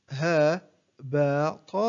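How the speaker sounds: one short syllable at a time, with pauses; broken into syllables. Shown as a demonstration. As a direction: separate each syllable for a learner to copy. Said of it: "أقطع الكلمة إلى مقاطع قصيرة". (تقطيع الكلمة مقطعًا مقطعًا بوقفات)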